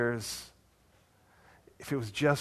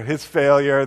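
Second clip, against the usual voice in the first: ordinary talk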